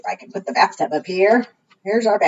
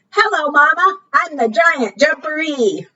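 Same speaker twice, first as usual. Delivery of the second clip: put-on cheerful baby frog; 8 semitones higher than usual.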